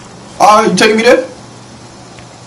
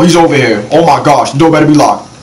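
ordinary talk, nothing marked

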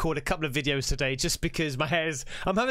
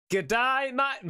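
higher, put-on Aussie greeting; mock Australian twang; Australian accent; 7 semitones higher, slower than usual. Reactor takes up the video's request, hammed-up Australian accent. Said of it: "but the Australian accent".

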